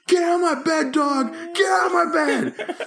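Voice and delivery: high-pitched hammed-up shout; retold screaming. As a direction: act out screaming the dog off the bed. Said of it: "I'm, like, screaming and everything".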